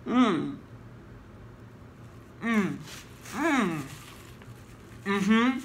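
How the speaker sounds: humming with full mouth; muffled through napkin; mouth full, chewing. Real, not acted. Seen 0: Bites burger before; chews with napkin pressed over mouth while humming.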